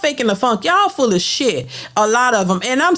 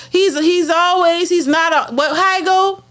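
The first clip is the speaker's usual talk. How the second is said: ordinary talk, nothing marked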